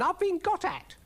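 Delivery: very high outraged squeal; shrieked snail complaint; shrieking. A narrator's reading. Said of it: "shrieked Brian".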